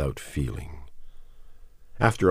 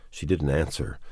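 ordinary delivery, nothing marked

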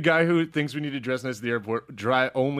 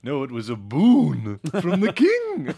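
fancy voice; 5 dB louder, slower than usual. Pretend. Eli's fancy voice.

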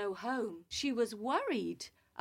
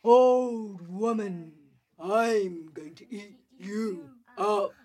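tiger voice; 3 semitones lower than usual. Acted. Tiger threatens old woman.

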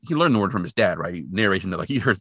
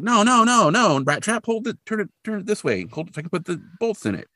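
high-pitched, impatient correcting; exasperated dad; 12 semitones higher than usual. Retold or imitated. Dad corrects son holding hubcap.

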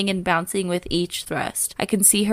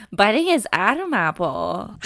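ordinary talk

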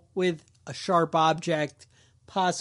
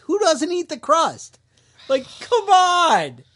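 high, loud disbelief with a sigh; who would ever do this; slowed incredulous complaint; exasperated; 9 semitones higher, 5 dB louder, slower than usual. Real, not acted. Exasperated rant about crust.

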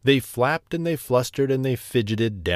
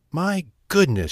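gobbling; 6 semitones higher than usual. A narrator's reading. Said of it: "she gobbled".

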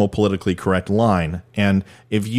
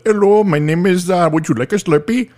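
higher, louder put-on Indian accent clerk; mock Indian accent; 8 semitones higher, 3 dB louder than usual. Host slips into a store clerk, mock Indian accent. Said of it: "That was racist".

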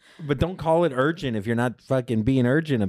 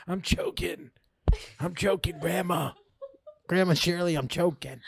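high, half-laughing mock panic; panicky; 8 semitones higher than usual. Grandson calls to grandma Shirley, mock-panicked and laughing. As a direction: plead like you're running out of air.

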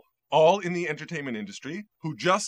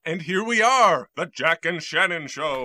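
announcer voice; 5 dB louder than usual. Acted. Announces the new show.

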